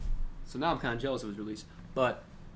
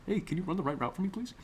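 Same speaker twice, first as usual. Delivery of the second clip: higher mock-meek request; overly polite quarterback; 5 semitones higher, 3 dB quieter than usual. Quarterback politely asks receiver to run his route, mock meek. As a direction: ask sweetly, imitating a too-nice quarterback. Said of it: "This is how he talks".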